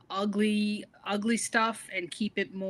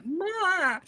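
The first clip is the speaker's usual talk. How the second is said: mock crying sound; 10 semitones higher than usual. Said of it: "We're both like".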